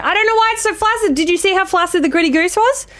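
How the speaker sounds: high, fast whiny complaint; mock whine. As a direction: whine like a sulky child. Mock whine about flaccid goose.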